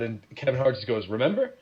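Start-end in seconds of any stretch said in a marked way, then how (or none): 1.1-1.5 mock pointed remember. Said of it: "don't say remember like that".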